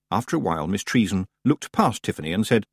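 ordinary delivery, nothing marked

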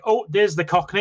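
Cockney slipping in. Retold or imitated. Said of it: "then he'll say something".

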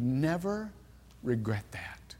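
soft unvoiced whisper on the last words. Real, not acted. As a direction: let it fall to a hushed whisper. Quiet moment in sermon.

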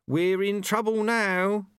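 high, slow dismayed voice; worried angel. A narrator's reading. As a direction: draw out the dread in a high voice. Angel alarmed by the text.

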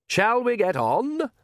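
slow, high-lilting question; smooth Count. A narrator's reading. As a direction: draw it out high like a suave vampire Count. Count calls his party onward.

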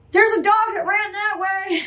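high, light passerby voice; bystander voice. Retold or imitated. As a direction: point it out like a helpful stranger. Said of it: "Everybody's like".

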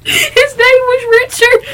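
barely getting the words out between laughs; laughing through words. Real, not acted. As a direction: voice high, cracking with laughter. Laughing hard about dead grandpa.

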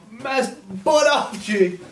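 strained, slow through the chilli heat; mouth burning from spicy food. Real, not acted. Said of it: "Oh, my eyes are watering".

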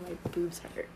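hushed whispered complaint; whispering. Real, not acted. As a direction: whisper it as an aside.

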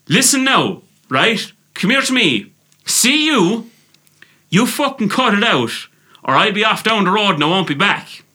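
high-pitched, firm Irish telling-off; putting foot down. Acted. Advising how to confront him, stern high-pitched ultimatum. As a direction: lay down the law, pitch way up. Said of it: "put the foot down and say".

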